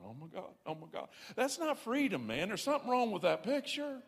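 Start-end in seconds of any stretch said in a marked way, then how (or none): 0.0-1.1 retold panic. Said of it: "freaking out in the beer aisle going".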